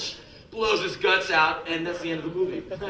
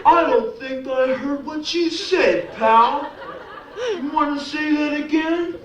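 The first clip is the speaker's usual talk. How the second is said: thick-headed bully squaring up, voice pushed high; 9 semitones higher than usual. Acted. Slow-witted tough guy challenges him.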